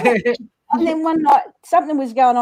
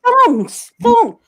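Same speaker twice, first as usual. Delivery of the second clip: high, loud, exasperated "thongs"; insistent repeat; 12 semitones higher, 5 dB louder than usual. Retold or imitated. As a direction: say "thongs" louder for someone who doesn't get it. Repeating it at baffled shoe staff.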